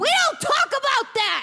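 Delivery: high-pitched furious yell; angry outburst. Acted. Hamster silences birds about a dead cage mate, furious outburst. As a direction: cut them off with a shrieked order. Outburst over a forbidden topic.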